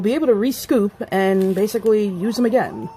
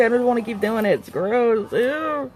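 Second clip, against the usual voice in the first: whiny disgust ending on a high "ew"; grossed out; 3 semitones higher than usual. Real, not acted. Vlogger reluctant to keep handling it, grossed out. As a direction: recoil from the sticky mess.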